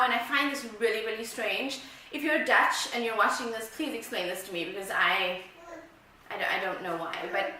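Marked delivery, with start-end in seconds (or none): none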